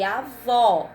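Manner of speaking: avó with an open final O; avó ending on a wide, open O; open final vowel. Shown as a demonstration. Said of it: "o som é aberto".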